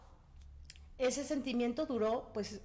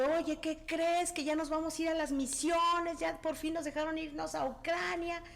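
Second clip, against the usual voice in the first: excited news; 6 semitones higher than usual. Retold or imitated. Husband's news voiced in story.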